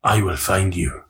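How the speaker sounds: grave, deliberate action hero; film tough-guy impression. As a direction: take your time with a deep film threat. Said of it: "Who did it the best?".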